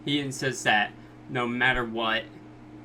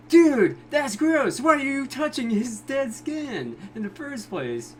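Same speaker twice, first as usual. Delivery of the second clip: disgusted shout; 12 semitones higher, 6 dB louder than usual. Retold or imitated. Reviewer yelling at Josuke for touching dead skin, disgusted shout. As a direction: yell it out, grossed out. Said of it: "So I'm just like".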